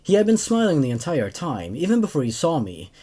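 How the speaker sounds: even first-person narration; story narration. A narrator's reading. Narrator telling of returning Marine, in even narration. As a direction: read it like a calm soldier's recollection. Reads second story aloud.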